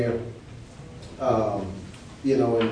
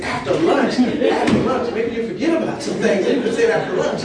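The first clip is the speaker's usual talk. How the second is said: high, loud and laughing through the words; laughing plea; 11 semitones higher, 9 dB louder than usual. Retold or imitated. Nervous student laughing as he begs the teacher to wait. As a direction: beg for later while cracking up.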